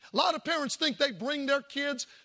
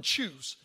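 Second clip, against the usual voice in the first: "choose" dropped low and drawn out; word emphasis; 10 semitones lower than usual. Shown as a demonstration. Preacher stressing "choose" for the congregation. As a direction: lean hard on "choose". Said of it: "notice that word".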